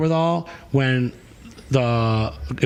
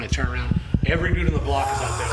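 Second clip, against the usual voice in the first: normal talking voice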